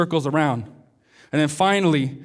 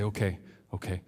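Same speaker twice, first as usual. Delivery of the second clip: hushed agreement; 11 semitones lower, 13 dB quieter than usual. Acted. Frightened man agrees on the radio.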